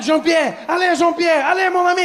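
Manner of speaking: put-on high panicked French accent shout; french chef. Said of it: "He's like".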